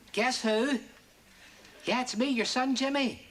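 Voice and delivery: normal talking voice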